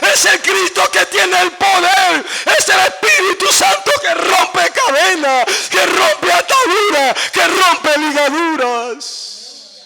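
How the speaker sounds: loud, high, shouted sermon; full-force preaching. Real, not acted. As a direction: shout it to the whole congregation in driving phrases.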